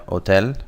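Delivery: "hotel" said as Spanish o-TEL; silent H. Shown as a demonstration. Teacher showing Spanish silent H, "hotel" without its H. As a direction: drop the H in "hotel". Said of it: "H's are silent in Spanish".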